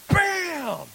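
a sudden high shouted "Bam!" like a hammer blow; imitated hammer blow. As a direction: hit the sound like a hammer strike. Imitating hammer hitting the trim.

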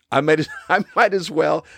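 a laugh breaking into the words. Real, not acted. Laughing as he restarts his own joke. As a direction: laugh it off mid-sentence.

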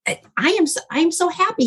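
high, happy gush; gushing to the young man at the art show, excited. Retold or imitated. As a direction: let the joy lift the voice up high. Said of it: "I'm like".

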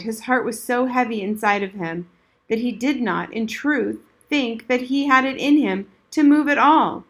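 plain reading voice

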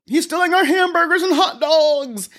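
high-pitched mock alarm; hammed-up wail of outrage; mock outcry. Host mimicking scare stories about stolen hamburgers, mock outcry. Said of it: "when you hear stories like".

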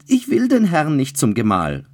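high, loud desperate cry; screamed refusal. A narrator's reading. Bride refuses Childeret at the altar, screaming. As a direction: fling the refusal out in panic. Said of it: "schrie Blanche".

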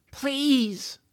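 high, drawn-out plea; pleading. Acted. George begging his crying dragon, pleading. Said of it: "George said".

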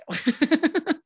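a short, amused laugh. Real, not acted. Laughs at son's defiance.

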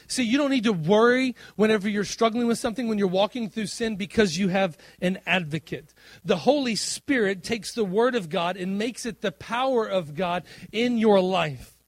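loud, high, driving sermon voice; preaching at full force. Real, not acted. Pastor assures the congregation about their advocate, loud preaching. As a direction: preach it out to the whole room.

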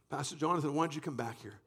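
ordinary talk, nothing marked

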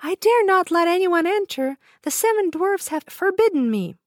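put-on high, innocent girl voice. A narrator's reading. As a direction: refuse politely but afraid.

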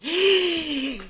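sharp gasp of mock horror; shocked gasp. Real, not acted. Friend gasps at the bike story, mock horror. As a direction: gasp as if scandalized.

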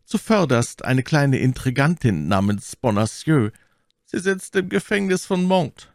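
high, theatrical Milady voice; high, formal courtly request; scheming lady petitioner. A narrator's reading.

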